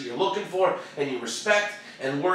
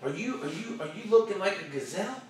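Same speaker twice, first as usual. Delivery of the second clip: timid gazelle question; 5 dB quieter than usual. Acted. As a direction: lift GAZELLE up at the end. Contrasts gazelle with lion.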